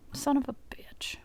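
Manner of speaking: hushed, frustrated whisper; whispered curse. Real, not acted. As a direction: curse under your breath. Setup fails again.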